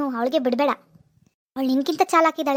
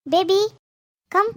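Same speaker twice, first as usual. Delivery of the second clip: higher, louder call across the house; mother calling her daughter to dinner, loud; 4 semitones higher, 3 dB louder, slower than usual. Acted. Mother calls daughter to eat.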